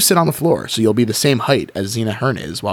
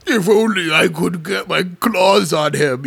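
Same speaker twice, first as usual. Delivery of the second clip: monster voice; 5 semitones higher than usual. Acted. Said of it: "you say".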